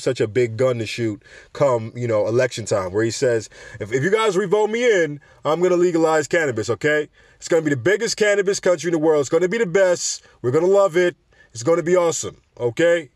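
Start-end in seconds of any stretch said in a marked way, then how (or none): 3.8-13.1 higher-pitched, loose politician impression; half-hearted parody of a boastful campaign promise; Donald Trump. Host mocking a candidate wooing voters, in a rough impression. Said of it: "I can't even do a Trump accent".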